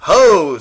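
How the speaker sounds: loud high scoffing ho; scornful ho. Acted. Wild rabbit scoffing at the toy, loud ho. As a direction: scoff at him. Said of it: "said the furry rabbit".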